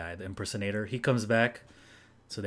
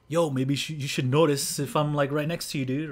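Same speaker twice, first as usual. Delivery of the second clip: louder, higher, cocky call for attention; mock brash callout; 5 semitones higher, 7 dB louder than usual. Suggesting Techi call out the fan, loud and teasing. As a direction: demand to be noticed, loud and pushy. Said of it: "maybe you should be like".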